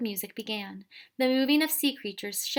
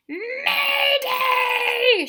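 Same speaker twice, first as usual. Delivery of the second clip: piercing screech, far higher and louder; shrill screech; 10 dB louder than usual. A narrator's reading. Wiffle bird sounding the alarm, a shrill screech. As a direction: shriek the alarm like a panicked bird. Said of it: "screeched in an incredibly shrill voice".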